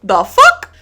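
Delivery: high loud disbelieving the fuck; shocked. Retold or imitated. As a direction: blurt it out in shock. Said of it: "they were like".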